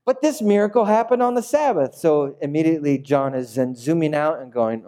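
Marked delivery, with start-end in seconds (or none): none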